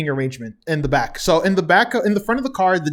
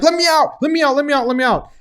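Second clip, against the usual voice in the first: mock panic; 9 semitones higher, faster than usual. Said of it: "he's like".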